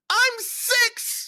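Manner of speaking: very high squealed protest; mock child outrage, way up high; outraged squeal. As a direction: squeal it like an indignant little kid. Child's outraged reply to mom, acted.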